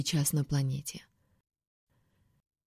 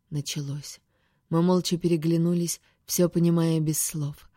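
ordinary delivery

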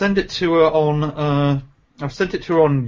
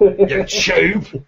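loud Cockney insult shout; Cockney accent; 5 semitones higher, 4 dB louder than usual. Shown as a demonstration. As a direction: snap it Cockney style. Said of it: "in a Cockney accent".

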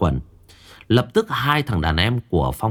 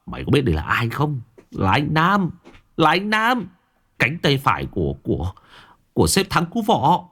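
gritted threat; 5 semitones higher than usual. A narrator's reading. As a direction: growl it through clenched teeth. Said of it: "Phong mặt sẹo gằn giọng".

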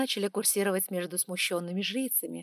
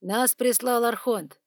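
man's voice; 4 semitones higher, 7 dB louder, slower than usual. Acted. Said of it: "мужской голос".

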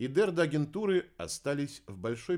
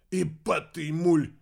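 loud, slow, higher curse; swearing; 7 semitones higher, 9 dB louder, slower than usual. A narrator's reading. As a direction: spit out the curse loudly. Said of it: "выругался Кеннет". (громкое медленное ругательство)

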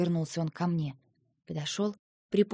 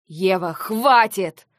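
much louder, slow, commanding; angry shout; 3 semitones higher, 11 dB louder, much slower than usual. Acted. Lentar ending the argument with Eva, angry shout. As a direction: shout her down. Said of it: "разозлился он".

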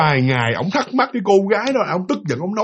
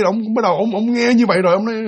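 normal talking voice

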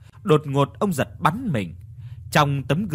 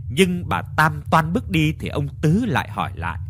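ordinary delivery, nothing marked

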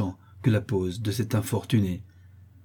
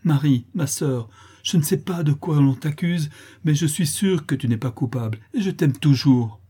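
crying out; 5 semitones higher, 4 dB louder than usual. Acted. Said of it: "s'écria".

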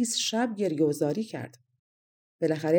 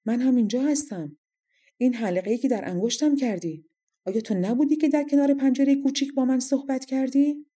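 higher-pitched, insistent interruption; cutting in; 7 semitones higher, 4 dB louder than usual. A narrator's reading. Toritela interrupting the king about the ring, insistent. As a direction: break in with a pointed challenge. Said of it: "توریتلا حرفش رو قطع کرد".

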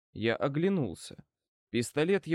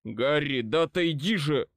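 gruffly impatient order; barked order. A narrator's reading. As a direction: bark at him to move. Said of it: "снова рявкнул мне Эбенизер". (сердито рявкнуть)

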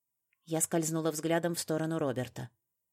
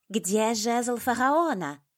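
slow, high, loud demand; insistent raised voice, stretched words; demanding cry; 7 semitones higher, 6 dB louder, much slower than usual. A narrator's reading. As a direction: demand it loudly, drawing it out. Villainess demands the staff.

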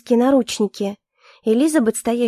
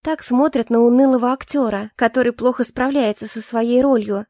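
usual speaking voice

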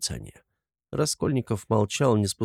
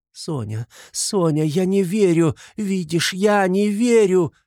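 fervent, high-pitched protest; crying out; 10 semitones higher, 5 dB louder than usual. A narrator's reading. Katerina holding Sonya, refusing to believe it, crying out. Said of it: "кричала".

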